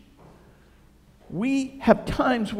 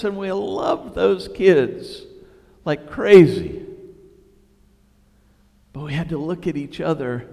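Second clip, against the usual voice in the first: normal talking voice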